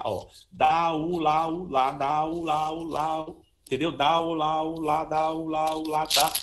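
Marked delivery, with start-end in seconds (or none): 0.5-3.4 "Dá, u, lá, u" chanted on an even pitch, no gaps; syllable phrasing demo. Shown as a demonstration. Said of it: "fraseado com dá, u, lá, dá". 3.9-6.3 "Dá, u, lá, u" in a level, monotone chain; syllable phrasing demo. Shown as a demonstration. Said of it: "essa pronúncia que eu já falei".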